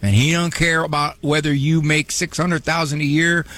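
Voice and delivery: usual speaking voice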